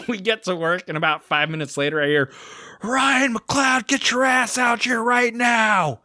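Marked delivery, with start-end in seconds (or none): 2.8-6.0 retold boss yell. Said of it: "who was the one yelling at me".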